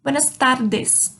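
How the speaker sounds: slow "tardes" with a little tongue flutter on r; careful Spanish model of "tardes"; tapped R. Shown as a demonstration. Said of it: "a língua dá uma tremidinha dentro da boca".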